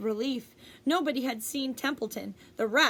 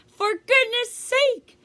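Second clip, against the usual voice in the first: loud, much higher, slow bellow; 11 semitones higher, 9 dB louder, slower than usual. A narrator's reading. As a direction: roar it out, drenched and fed up. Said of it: "bellowed Mister Zuckerman".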